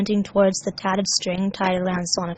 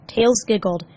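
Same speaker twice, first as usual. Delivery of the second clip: ordinary delivery, nothing marked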